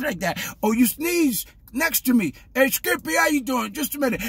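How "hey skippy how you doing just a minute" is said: higher-pitched acted chatter; acted greeting. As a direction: lightly play someone stopping to chat. Acts out someone sneezing beside him.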